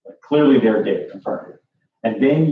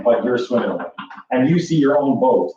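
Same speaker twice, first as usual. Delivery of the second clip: ordinary talk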